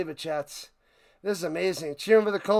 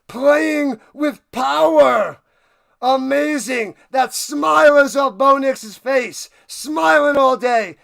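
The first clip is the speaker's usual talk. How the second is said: loud, high victory shouting; ecstatic play-by-play; 6 semitones higher, 7 dB louder, slower than usual. Real, not acted. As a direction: stretch the big words in triumph. Live celebration of the win.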